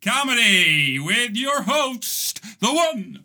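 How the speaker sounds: high, building announcer hype ending in a shout; hyped-up host intro. Pretend. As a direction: sell the host like a cheesy game show. Restarts the intro bigger.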